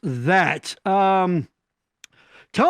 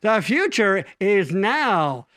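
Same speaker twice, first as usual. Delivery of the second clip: dramatic slow proclamation; 2 semitones lower, much slower than usual. Pretend.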